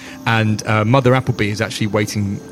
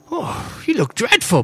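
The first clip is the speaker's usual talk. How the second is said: high, gushing camp diva voice; campy drag queen; 13 semitones higher than usual. Acted. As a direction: greet them like a flamboyant drag queen. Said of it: "She says".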